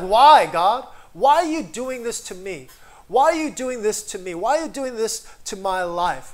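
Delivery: loud pleading protest at God; a man yelling up at heaven; mad at God. Retold or imitated. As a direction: demand answers, aggrieved. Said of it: "he was mad at God".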